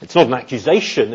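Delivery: ordinary delivery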